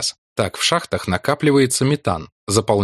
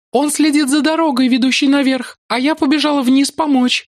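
put-on young woman voice; 4 dB louder than usual. Ruth's voice held.

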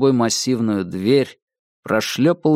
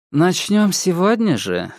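higher, lightly voiced womanly double; feminine voice; 7 semitones higher than usual. A narrator's reading. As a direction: ask it high, with a hint of a woman's voice. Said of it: "насколько женственный у него голос".

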